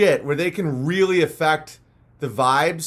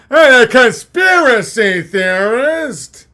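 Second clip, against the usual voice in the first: higher, louder, slow accusing call; mock German shout; 4 semitones higher, 7 dB louder, slower than usual.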